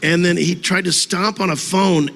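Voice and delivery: normal talking voice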